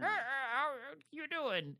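put-on high, frail old granny; quavery little old lady. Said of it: "has the granny voice".

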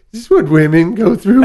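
high, loud mock disbelief; mock shock. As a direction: squeal it in exaggerated disbelief. Mock exasperation.

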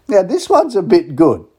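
slow, high and loud, savouring the words; hyping up the next song for listeners; excited tease. Real, not acted. Radio host teasing a Christmas song, high and excited.

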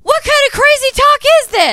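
high, loud overacted Connie; bad acting. Connie overacts disbelief at the accusation. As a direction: ham up the fake outrage. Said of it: "the acting, like, turns on".

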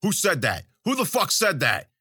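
rising irate challenge; angry demand. A narrator's reading. Zoot hunting the heckler in the crowd, angry.

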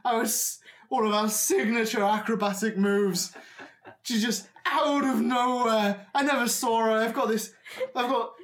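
loud, high wailing with pain; melodramatic goblin. Acted. Old goblin making a huge deal of his broken leg, melodramatic wailing. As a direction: wail like a hammed-up injured acrobat. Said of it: "making the most dramatic sort of, like, deal".

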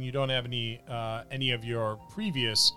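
ordinary talk

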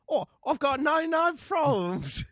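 high, put-on English accent. Mistaking how the rap goes, hammed-up English accent. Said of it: "with an English accent".

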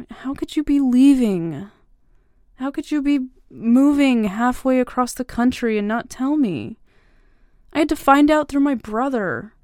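confronting him about moving away; hurt anger. Acted. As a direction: demand an answer, stung. Hurt confrontation over leaving.

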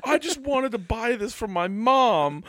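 high, hammed-up lament; aggrieved customer. Shopper venting to Linda, mock heartbroken. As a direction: pour out the grievance in a high mock voice.

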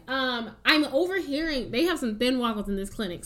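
ordinary talk, nothing marked

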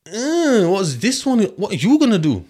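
mock the friends pressing him; imitating the mandem. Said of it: "the man them was like".

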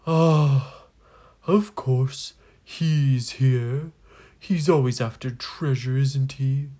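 loud, weary exasperation; exasperated ghost. Acted. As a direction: grumble loudly about that same old rival. Ghost grumbles about the returning rival.

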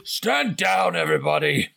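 high, projected mock yell; yelled order. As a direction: call out a command to the whole camp. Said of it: "he just yells".

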